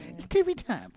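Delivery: high, bright catchphrase call; excited catchphrase. Retold or imitated. Hyping up the catchphrase to his co-host, high and excited. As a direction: announce it like a hype line.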